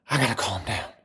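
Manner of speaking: low, soft murmur to yourself; hushed self-soothing. Real, not acted. Excited streamer trying to calm down, hushed self-talk. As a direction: pull the energy right down.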